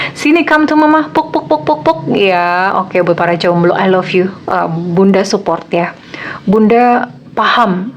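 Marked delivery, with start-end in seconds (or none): 0.1-2.0 put-on higher, cooing pat-pat to a child; soothing lonely singles like a baby; mock motherly comforting. (menghibur para jomblo seperti ibu menenangkan bayi)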